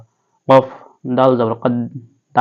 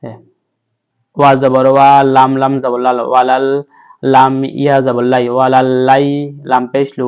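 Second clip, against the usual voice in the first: usual speaking voice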